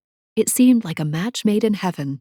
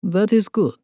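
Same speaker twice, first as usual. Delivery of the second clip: a hint of a Russian accent, taking his time; light Russian accent; 3 semitones higher than usual. A narrator's reading. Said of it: "his rich accent quite delicious".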